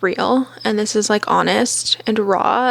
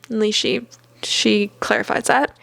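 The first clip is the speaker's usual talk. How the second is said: ordinary talk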